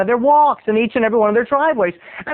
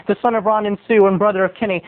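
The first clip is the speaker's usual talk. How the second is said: ordinary delivery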